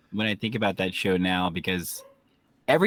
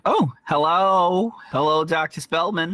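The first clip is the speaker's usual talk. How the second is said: sing-song phone greeting; 7 semitones higher, 5 dB louder, slower than usual. Acted. Character answering phone in play.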